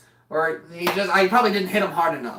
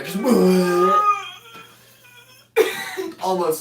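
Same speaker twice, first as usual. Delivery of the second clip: deflated whoopee cushion noise; 10 semitones higher than usual. Shown as a demonstration. Showing how a winded person sounds, high deflating wheeze. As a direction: squeal like air leaking from a whoopee cushion. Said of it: "sound like a deflated whoopee cushion".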